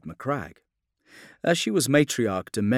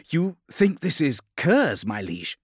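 questioning officer; 7 semitones higher, 6 dB louder than usual. A narrator's reading. Redloss questions his liege.

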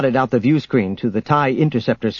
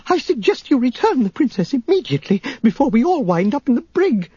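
much higher, agitated robot voice; fussy alarm, pitched way up; Three P O; 12 semitones higher than usual. A narrator's reading. Droid urging the pilot to turn back, high-pitched alarm. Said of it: "Three P O shouted".

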